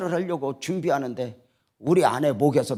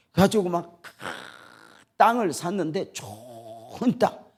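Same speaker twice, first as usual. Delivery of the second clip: ordinary delivery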